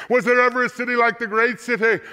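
high, ringing outcry; crying out. A narrator's reading. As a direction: cry it out high, as a dramatic reader. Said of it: "will cry out as they see the smoke".

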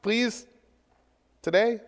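wheedling invitation, half-hearted; mock pleading. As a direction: beg them to follow, lightly mocking. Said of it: "He doesn't say".

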